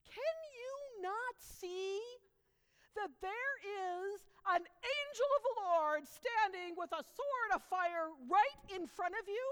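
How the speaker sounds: high, loud pleading donkey voice; a loyal donkey begs to be believed; donkey pleads with Balaam, high and loud; talking donkey. Acted. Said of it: "And the donkey says".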